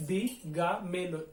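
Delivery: दिगामेलो slowly, one syllable at a time. Shown as a demonstration. Teacher shows how to cut the word, दिगामेलो syllable by syllable. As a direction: separate दि, गा, मे, लो for the learner. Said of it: "एक एक सिलाबा काटिए".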